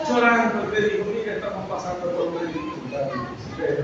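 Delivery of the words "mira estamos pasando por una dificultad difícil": a hint of tearful quiet, much softer and lower; lightly voiced weeping sister, hushed. Church sister on the phone asking for prayer, softly tearful. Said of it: "me saludó llorando".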